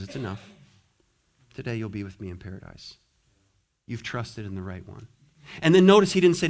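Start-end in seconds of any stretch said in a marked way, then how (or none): 0.0-5.1 tender Jesus. Retold or imitated. Jesus comforting the dying thief.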